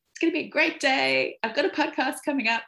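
put-on high, quick and sing-song to the kids; chirpy self-quote. Mum announcing her great day to the kids, chirpy and quick. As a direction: sell the great-day cheer fast and bright. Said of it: "And I was like".

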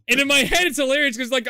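ordinary talk

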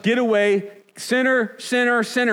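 high, loud mocking chant of "sinner"; mock shunning cry. Pastor imitating religious leaders shooing sinners away, mock chanting. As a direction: call out "sinner" like a scandalized Pharisee.

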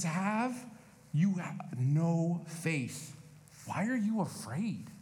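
drawn-out "you no faith"; slow gentle rebuke. Retold or imitated. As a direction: ask it slowly and gently. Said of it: "He says".

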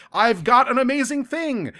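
high-pitched, excited proclamation in mock-grand style; excited announcement. Vedic arriving with an amazing thing, excited mock announcement. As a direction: play the thrilled bringer of big news. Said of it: "shows up and he's like".

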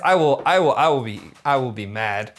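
ordinary talk, nothing marked

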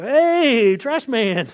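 calling out greeting. Retold or imitated. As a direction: hail a man across the way. Acts out calling to garbage man.

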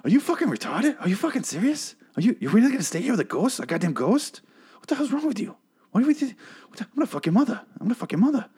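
very high, incredulous celebrity impression. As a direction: pitch it way up in pleading disbelief. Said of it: "you have to do [name] impression".

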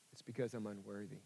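soft, quick admission of unworthiness; quiet confession. Retold or imitated. A churchgoer under truth serum, quietly confessing. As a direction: murmur it like a secret shame. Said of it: "You would say".

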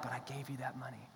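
a soft whisper; God whispering a reminder. Retold or imitated. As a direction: breathe it like a conscience. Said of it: "God's like".

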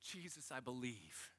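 very hushed, soft confession; prayer from the heart. Retold or imitated. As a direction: retell a silent prayer, barely voiced. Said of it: "all I said in my heart was".

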